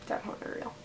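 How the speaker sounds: breathy whispered echo of the line; whispered aside. Real, not acted. Vlogger whispering to her viewers, a quiet echo. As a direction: drop to a whisper for the repeat.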